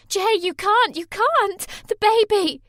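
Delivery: high, loud panicked begging; desperate scream. Acted. Sammy begging Jay to stop kicking her, screaming. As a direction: scream to make him stop. Sammy begs while being kicked.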